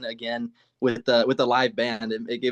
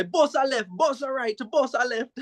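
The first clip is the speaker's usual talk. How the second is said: high, playful Jamaican dancehall chant; Jamaican accent; 11 semitones higher than usual. Pretend. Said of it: "can you give us your Jamaican accent".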